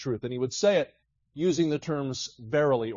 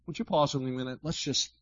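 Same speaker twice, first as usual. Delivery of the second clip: usual speaking voice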